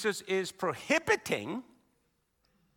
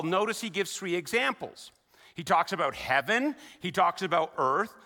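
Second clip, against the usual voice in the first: ordinary talk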